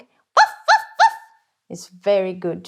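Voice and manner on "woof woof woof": very high, loud yappy little dog bark; tiny dog bark. Shown as a demonstration. Teacher barking like a tiny dog for the kulning sound. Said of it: "this tiny dog".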